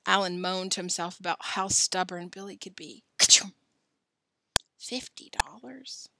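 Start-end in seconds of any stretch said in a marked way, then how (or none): 3.2-3.5 loud, explosive mock sneeze; acted sneeze. Joe imagining Billy sneezing on pepper, explosive mock sneeze. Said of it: "Joe sneezing". 4.8-6.1 slow, whispery moan; hushed disbelief. Acted. Alan moaning to himself over the bet, hushed disbelief.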